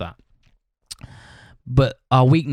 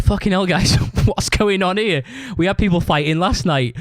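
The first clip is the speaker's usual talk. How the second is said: mock dismay; 6 semitones higher than usual. Owner's reaction to the fight, acted.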